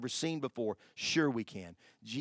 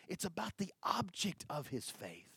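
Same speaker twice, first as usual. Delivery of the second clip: whispered aside; 5 semitones higher, 8 dB quieter than usual. Acted. Whispered emphasis.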